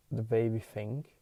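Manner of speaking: usual speaking voice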